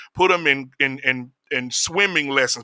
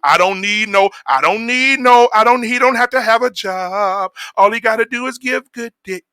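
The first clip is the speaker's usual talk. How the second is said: higher mock quote of the lyric; imitating the singer; 6 semitones higher, 3 dB louder than usual. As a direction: lightly parody her line. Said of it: "When she said".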